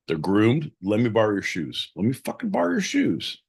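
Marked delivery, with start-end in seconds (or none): 2.0-3.4 high-pitched insistent begging; insistent plea. Acted. Host begs John to lend his shoes, high pleading.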